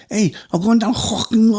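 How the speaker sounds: high, strangled Liverpudlian; choked caricature of a Scouser; strangled Scouse. Acted. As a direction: talk Scouse as if being strangled. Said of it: "they're being strangled".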